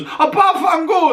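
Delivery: high mock Italian exclamation; mock Italian accent. Mocking [name]'s accent in House of Gucci, hammed-up Italian. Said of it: "a second ago she was".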